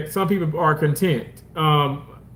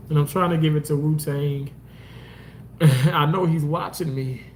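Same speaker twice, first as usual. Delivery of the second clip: ordinary talk, nothing marked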